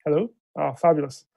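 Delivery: normal talking voice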